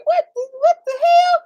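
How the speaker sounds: very high, laughing disbelief; acted bewilderment. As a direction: squeal it in mock confusion. Retelling confusion at voices in bed.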